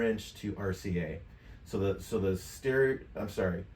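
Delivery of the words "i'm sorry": usual speaking voice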